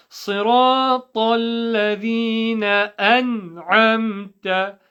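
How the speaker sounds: slow chant with long vowels; measured teaching chant; Quranic recitation. Shown as a demonstration. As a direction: recite each letter firmly and clearly.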